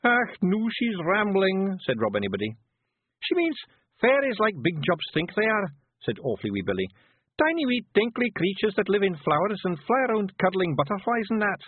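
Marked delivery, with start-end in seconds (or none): 0.0-1.8 high, rough little Scottish pictie, gently; a tiny Scots warrior breaking bad news; Feegle voice. Rob, slow and exasperated. 3.2-11.6 high, rough little Scottish pictie, gently; a tiny Scots warrior breaking bad news; Feegle voice. Billy explains.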